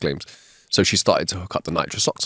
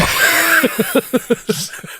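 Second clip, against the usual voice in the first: laughing. Real, not acted. Laughs at punchline.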